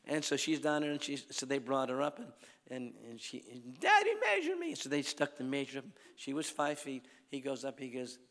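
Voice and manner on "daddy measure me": put-on high, loud childlike plea; little girl pleading. Daughter begs her dad to measure her, high childlike plea. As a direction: squeal it like an eager little girl.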